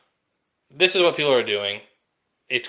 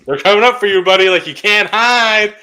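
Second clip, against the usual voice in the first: mock taunting yell; 6 semitones higher, 7 dB louder than usual. Brother taunting the crying kid.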